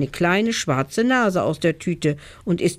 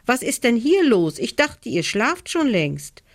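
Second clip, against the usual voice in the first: surprised mother; 6 semitones higher than usual. A narrator's reading. Mama finds the girls awake.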